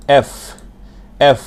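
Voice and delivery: এফ with air pushed out on the f; breathy F. Shown as a demonstration. Teacher demonstrating airy এফ to students. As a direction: let air rush through the lips on এফ. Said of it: "মুখ দিয়ে বাতাস বের হয়ে যাবে".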